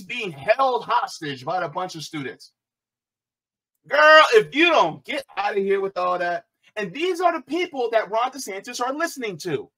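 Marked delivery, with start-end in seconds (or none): 3.9-6.4 sassy shout. Real, not acted. Mocking the woman's complaint.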